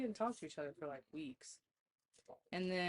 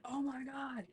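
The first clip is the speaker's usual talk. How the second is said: excited brother; 6 semitones higher than usual. Retold or imitated. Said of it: "He's like".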